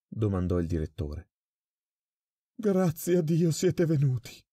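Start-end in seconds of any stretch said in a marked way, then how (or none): 2.6-4.4 high, slow, desperate exclamation; relieved terrified cry. A narrator's reading. Prisoner crying out to the arriving guards in high terror. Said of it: "esclamò il prigioniero".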